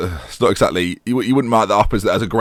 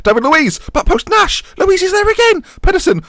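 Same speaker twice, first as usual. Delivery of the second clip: rising higher and louder, name after name; commentary getting carried away, voice climbing; pitch climbing with excitement; 5 dB louder than usual. Real, not acted. Calling his own match, voice climbing with excitement. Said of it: "My voice is getting higher and higher".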